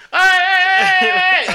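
high shouted hey hey to the riders ahead; calling out. Retold or imitated. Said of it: "trying to get their attention".

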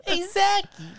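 squeaky mock voice. Mocking the friend's line.

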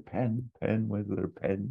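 lightly voiced fussy search; a hint of frantic Basil; imitating Basil. Hotelier hunting for a pen, lightly voiced fluster. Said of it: "behind the desk saying".

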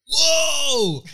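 loud, high mock "whoa" of admiration; amazed shout. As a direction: imitate a friend loudly marvelling. Acts out friend grabbing his forearms.

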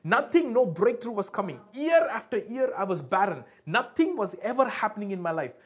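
loud, impassioned testimony; getting excited. Acted. Mother recounting year after year barren, loud and excited. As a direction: drive each phrase home at full preaching volume. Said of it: "speaker is getting excited".

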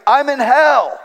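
a hint of a screaming man; mock yell of a patient in terror; retold scream. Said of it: "he screamed".

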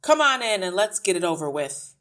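loud, grudging invitation; snapped. A narrator's reading. Father grudgingly lets the teacher in, snapping. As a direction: let her in with loud irritation. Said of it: "he snapped".

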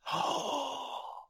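big theatrical intake of breath; hammed-up shocked gasp; mock gasp. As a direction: gasp as if scandalised by the detail. Pretends a pedant's shocked discovery.

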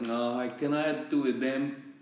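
put-on slow, lightly Jamaican accent; a rough Jamaican accent impression. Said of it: "full Jamaican".

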